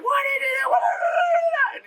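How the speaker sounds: very high, shrieked accusation, second one stretched; hysterical mock shriek. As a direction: imitate a woman screeching in panic. Sister storms in, acted freak-out.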